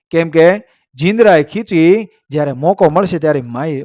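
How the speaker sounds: plain reading voice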